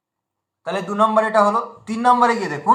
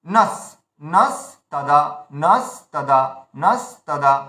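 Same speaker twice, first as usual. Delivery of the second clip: নাস held, pause, then lower তাদা; lengthened syllable; 6 semitones lower, slower than usual. Shown as a demonstration. Teacher drills the long syllable, নাস তাদা split by a gap. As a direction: drill নাস তাদা in two separate beats. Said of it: "দীর্ঘ উচ্চারণে সহযোগিতা করছে".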